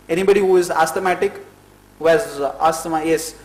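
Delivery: ordinary talk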